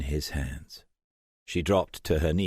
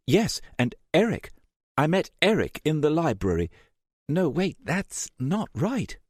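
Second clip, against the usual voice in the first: put-on higher, anxious young woman piecing memories together; lightly voiced Jessica, confused and urgent; Jessica inner voice; 6 semitones higher than usual. A narrator's reading. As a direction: voice her racing thoughts, rising with panic.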